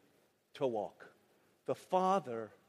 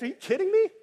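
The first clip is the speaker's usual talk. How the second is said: high, loud disbelief; rising shout of disbelief; incredulous outcry; 12 semitones higher, 5 dB louder, faster than usual. Real, not acted. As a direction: shout it as if you can't believe it.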